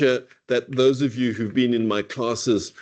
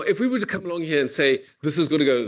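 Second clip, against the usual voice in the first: ordinary delivery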